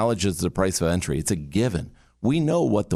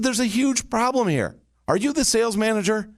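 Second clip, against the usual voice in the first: much higher, pressing and urgent; urgent insistent shift; 13 semitones higher than usual. Retold or imitated. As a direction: sound like it can't wait a second. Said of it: "This is the shift".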